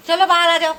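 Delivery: high, loud call to come out; loud come-out-now call. Real, not acted. Calling child out.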